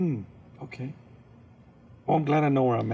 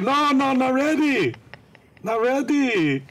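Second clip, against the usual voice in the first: panicked plea; 12 semitones higher, 7 dB louder, slower than usual. Retold or imitated. Pleading with nurse.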